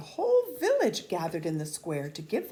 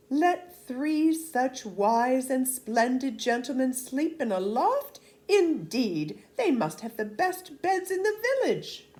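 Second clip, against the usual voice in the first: higher, louder insisting villagers; eager peasants; 7 semitones higher, 5 dB louder than usual. Acted. Peasants offering soldiers their best beds, eagerly insisting. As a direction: gush over the fine gentlemen.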